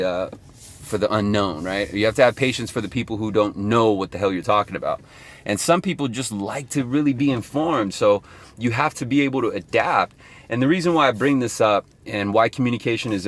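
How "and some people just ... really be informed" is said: usual speaking voice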